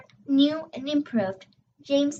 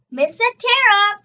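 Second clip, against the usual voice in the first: calling out a name, louder and higher; a burst of joy at the door; excited call; 7 semitones higher, 5 dB louder than usual. A narrator's reading. A child in class, seeing the lost teacher return, calls out in an excited shout.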